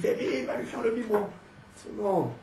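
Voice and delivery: high-pitched mock wife; épouse imitée. Elle dit. (imitation aiguë de l'épouse)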